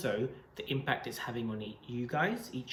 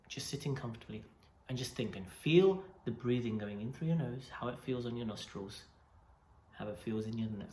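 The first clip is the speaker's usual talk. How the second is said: guided meditation; 2 semitones lower, 6 dB quieter than usual. Acted. Leads breathing meditation.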